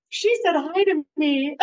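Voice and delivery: high-pitched mock delight; mock gushing. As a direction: squeal it in joking wonder. Mocking the low bar, laughs.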